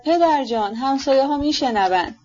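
understated cry, pitched high. A narrator's reading. Varya to her father about listening neighbours, a restrained cry. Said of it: "واریا فریاد زد".

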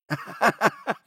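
high-pitched giggle. Acted. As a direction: giggle before speaking. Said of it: "او خندید".

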